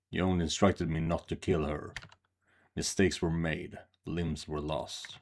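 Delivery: low, quiet matter-of-fact report; understated swordsman. Acted. Swordsman reporting to his lord on the captive witch, subdued.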